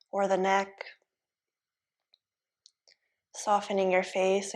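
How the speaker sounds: soft, even yoga-teacher voice; hushed, level meditation guidance; guided relaxation. Real, not acted. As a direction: ease them into stillness, softly, with long pauses. Leads guided relaxation in pose.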